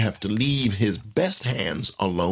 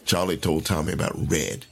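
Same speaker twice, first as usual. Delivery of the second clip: ordinary delivery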